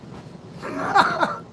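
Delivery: short high burst of laughter; real laughter. Real, not acted. Streamer teasing a viewer in chat, high laughter. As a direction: crack up briefly.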